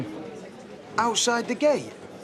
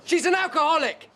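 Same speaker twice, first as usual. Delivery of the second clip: high, angry retort; exasperated outburst; 8 semitones higher than usual. Acted. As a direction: throw it out in high-pitched frustration. Row over alcoholic mother.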